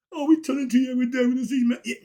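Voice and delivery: high, loud, rushed mimicry of fans; mock audience protest. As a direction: gush it fast and high, imitating eager followers. Mimicking his audience's flattering protest.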